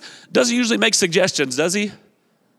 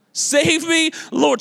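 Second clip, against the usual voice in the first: mock cry for help; 10 semitones higher, much slower than usual. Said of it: "can anybody relate".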